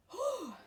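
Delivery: high, loud exhale of relief; winded whew. Real, not acted. Pregnant woman telling of getting out of breath, winded whew. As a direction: blow out a big "whew".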